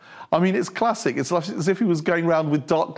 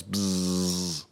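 low, soft, sustained buzz; imitated materializing buzz; 11 semitones lower, 6 dB quieter than usual. Jesus materializes like early Star Trek, low buzzing effect. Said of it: "Star Trek, the early episodes".

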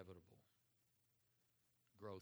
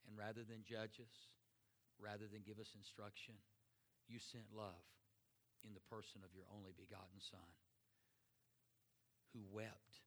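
closing prayer. Acted. Pastor leads closing prayer.

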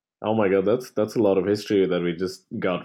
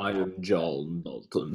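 low, slow, hammed-up introduction; deep, hushed and portentous, playfully overdone; mock-dramatic reveal; 4 semitones lower, 8 dB quieter, slower than usual. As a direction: draw it out softly like a mock movie reveal. Hammed-up echo of story's twist.

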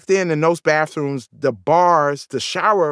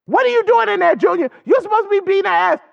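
high, sharp scolding call; mom's indignant yelling; 3 dB louder, faster than usual. Retold or imitated. Mom calling in to dad from outside, indignant high scolding. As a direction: holler at your husband in an outraged high voice.

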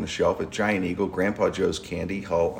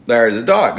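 mock exclaim; 2 semitones higher, 8 dB louder than usual. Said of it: "I go".

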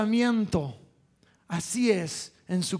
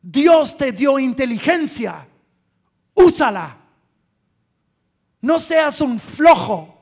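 stern rebuke; 3 semitones higher, 7 dB louder than usual. Retold or imitated. Father confronting his careless son hard, loudly and sternly. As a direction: lay down the law. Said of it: "con fuerza, duro, diciendo".

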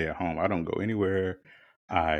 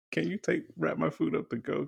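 mock-panicked request; 10 semitones higher than usual. Retold panicky request to leave.

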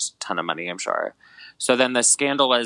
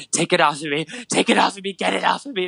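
panicked plea; 9 semitones higher than usual. Retold or imitated. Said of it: "and she's like".

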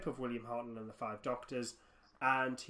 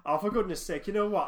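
loud, higher, exasperated burst; exasperated outburst; 6 semitones higher, 8 dB louder than usual. Real, not acted. Podcaster admitting they overthink a silly story, exasperated. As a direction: burst out in light-hearted exasperation.

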